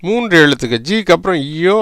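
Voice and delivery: usual speaking voice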